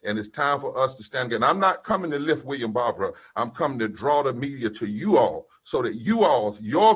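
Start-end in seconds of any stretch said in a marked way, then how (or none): none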